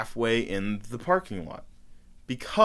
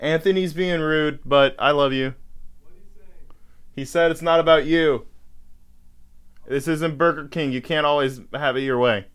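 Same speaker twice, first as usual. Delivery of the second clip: loud, raised voice carrying to the next room; calling out; 3 semitones higher, 5 dB louder than usual. Real, not acted. Host relaying Anthony's words to Chris outside, calling loudly. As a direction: project each phrase to someone out of earshot.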